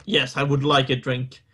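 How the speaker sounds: normal talking voice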